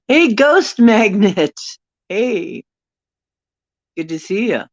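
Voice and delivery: high, slow, loud hey Ghost Magnet; cheery greeting. Real, not acted. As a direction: call out a welcome to a viewer. Greeting viewer.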